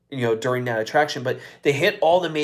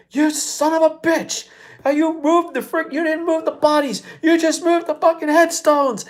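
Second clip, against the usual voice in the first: furious yelling father; 13 semitones higher than usual. Retold or imitated. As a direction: rant it like a father who just found the bodies. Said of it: "you hear him saying".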